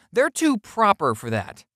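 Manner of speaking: plain reading voice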